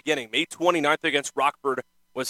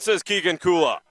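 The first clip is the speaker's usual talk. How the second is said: ordinary delivery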